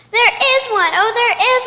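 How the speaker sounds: delighted discovery. Acted. Girl finding a swing behind new house, delighted. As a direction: squeal with delight.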